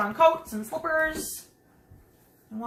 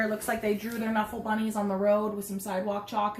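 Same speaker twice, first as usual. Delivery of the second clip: usual speaking voice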